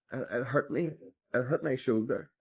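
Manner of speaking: ordinary talk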